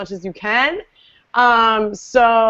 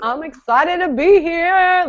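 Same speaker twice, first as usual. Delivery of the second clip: hoarse, raspy speaking voice; rough raspy grandma voice; 5 semitones higher than usual. Real, not acted. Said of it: "Listen to my horrible grandma voice".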